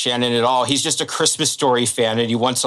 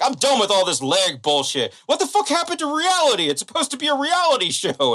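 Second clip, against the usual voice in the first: very high-pitched indignant wail, imitated; hammed-up distraught complaint; mock tearful meltdown; 9 semitones higher than usual. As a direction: protest like a man freaking out near tears. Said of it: "he's, like, in tears".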